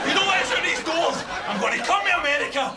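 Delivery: high, shouted threats; escalating, worked-up tirade; furious rant. Acted. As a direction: rage at the machine.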